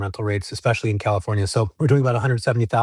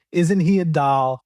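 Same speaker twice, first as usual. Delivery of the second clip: imitated North Dakota accent, higher and drawn out; Barbara's Fargo accent; 4 semitones higher, 4 dB louder, slower than usual. Quoting a nursing-station woman to the host, Fargo accent. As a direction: put on an amateurish, slow Fargo accent. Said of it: "she had this amazing accent".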